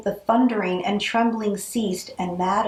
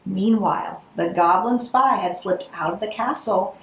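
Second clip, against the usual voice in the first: plain reading voice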